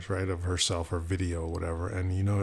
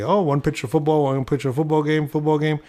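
mock excited; 7 semitones higher, 5 dB louder, faster than usual. Mimicking a themed photo post.

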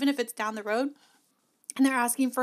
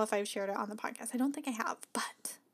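whispered aside; 2 semitones lower, 8 dB quieter than usual. Real, not acted. Aside about whether it was shared.

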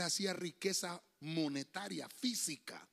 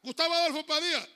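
a hint of a teacher barking the name, louder; teacher calling roll; 7 semitones higher, 9 dB louder, faster than usual. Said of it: "Cuando el profesor decía". (el profesor pasa lista, un poco más fuerte)

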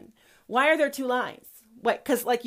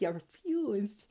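"refused" said softer and slowly; quiet slow repeat; 7 dB quieter, slower than usual. Acted. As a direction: let "You refused" sink in, quiet and slow. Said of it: "God says these to the transgenders".

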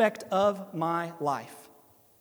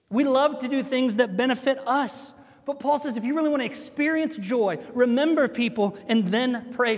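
preaching at full force; 5 semitones higher, 4 dB louder than usual. Acted. Preaching climax.

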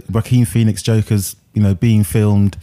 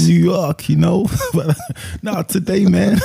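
put-on New York accent; 8 semitones higher than usual. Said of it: "he went into his John Gotti mode".

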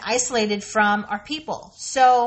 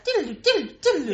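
high, laughing diddle-doo effect; sing-song TV flashback sound, playfully; imitated flashback jingle; 5 semitones higher, much faster than usual. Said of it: "like on Wayne's World".

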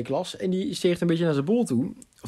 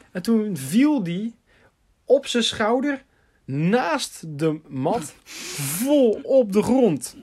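drawn-out, higher-pitched climax of a tale; slow-motion account of a fall; dramatic storytelling; 5 semitones higher, slower than usual. Real, not acted. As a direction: stretch each beat, pausing before the impact.

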